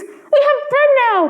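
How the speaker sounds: put-on very high, joyful children's cry; high happy shout of poor children. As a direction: burst out with glee like hungry kids fed. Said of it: "they cried".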